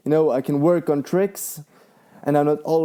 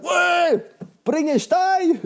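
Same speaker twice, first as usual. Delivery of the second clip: high-pitched builder's yell; imitated screaming; 14 semitones higher, much slower than usual. Guy building the walls calls for stone, imitated screaming. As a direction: yell it out like a bricklayer across the site. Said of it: "Then somebody screaming".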